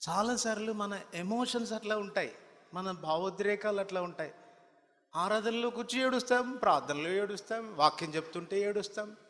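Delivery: high, loud fiery preaching; preaching at full force. Acted. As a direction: drive the rebuke home from the pulpit. Preacher rebukes congregation. (ఉద్రేకంగా బిగ్గరగా ప్రసంగం)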